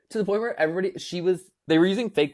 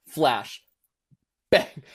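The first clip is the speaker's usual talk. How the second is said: ordinary delivery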